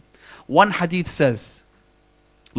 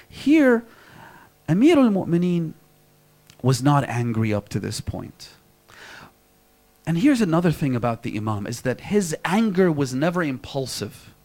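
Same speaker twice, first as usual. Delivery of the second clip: ordinary talk, nothing marked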